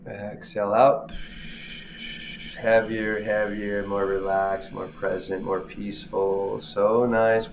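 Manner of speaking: measured, soothing meditation cues with long pauses; guided breathing meditation. Real, not acted. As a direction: guide them through each breath, drawing out the in and out. Leads a breathing exercise.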